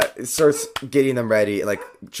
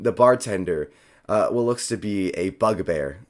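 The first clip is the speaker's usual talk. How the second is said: ordinary delivery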